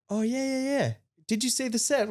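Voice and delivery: high, eager acted-out reply; high-pitched chirpy agreement. Band replies eagerly to admiring stranger.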